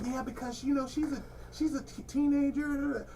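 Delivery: lightly voiced tactful mother, fading out; mock polite lady. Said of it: "She was like".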